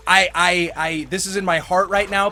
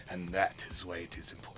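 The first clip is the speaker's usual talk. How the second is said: much lower, much softer wise teacher; low quiet sensei; 11 semitones lower, 15 dB quieter than usual. Retold or imitated. The sensei calmly explaining to the kids, low and soft. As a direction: drop to a hushed, solemn lesson. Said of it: "And he would go".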